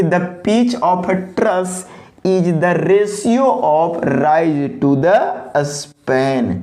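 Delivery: very slow, every word drawn out for copying; dictation pace. Shown as a demonstration. Teacher dictating the definition for students to copy, very slowly. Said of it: "copy भी करेंगे pause करके".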